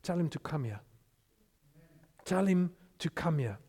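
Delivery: soft, low, unhurried instruction; quiet command. Retold or imitated. Jesus stops for the beggar, speaking softly. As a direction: drop right down and say it quietly.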